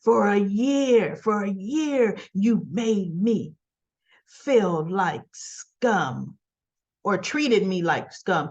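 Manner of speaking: drawn-out, deliberate accusation; slow bitter reproach. Retold or imitated. Daughter confronting mother over past treatment, slow bitter reproach. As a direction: stretch every word as you act out her hurt. Acted reproach after the revelation.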